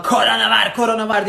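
hammed-up alarm shout; mock shouting. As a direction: parody their panicked shouting. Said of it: "diye bağırıyorsunuz".

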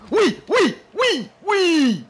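high, urgent bursts of yes; real gamer excitement; excited cheering. Real, not acted. Chance to score in live match.

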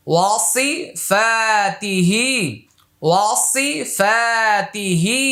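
ওয়াসিফাতিহি read slow and even; slow model reading. Shown as a demonstration. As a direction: model the whole word slowly. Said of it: "teacher models word slowly".

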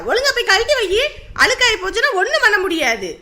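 mother scolding. Acted. Mother scolds daughter for trying on the new sari, loud and high. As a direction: tell her off for wearing it.

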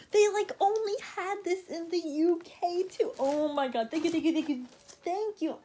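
still gushing. Real, not acted. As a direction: pour out grateful thanks. Overjoyed about the shirt.

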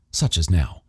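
ordinary delivery, nothing marked

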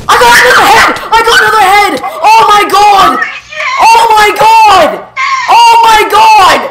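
high, loud, laughing shout, slowed; yelling so loud. Real, not acted. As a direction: scream it out in wild disbelief. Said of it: "sorry for yelling so loud".

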